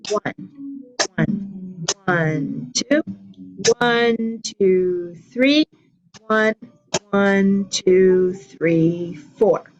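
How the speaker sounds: steady counting the beat aloud; counting along. Shown as a demonstration. Teacher counting rhythm while the class claps. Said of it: "So here we go".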